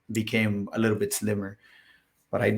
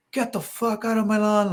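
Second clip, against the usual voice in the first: going at someone, acted out at a raised pitch; retold angry homeowner; 10 semitones higher than usual. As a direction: imitate a man chasing off a salesman. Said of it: "he just started going at me".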